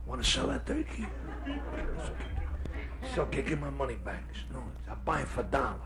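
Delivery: soft, furtive closet bargaining; whispery, secretive back-and-forth; hushed haggling. Acted. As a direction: keep the deal quiet.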